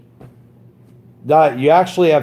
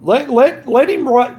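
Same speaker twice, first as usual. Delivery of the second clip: much higher and louder, stumbling on "let"; raised rant; 12 semitones higher, 4 dB louder than usual. Real, not acted. Streamer daring his rival to report him, heated shout. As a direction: fire it off in a heated burst.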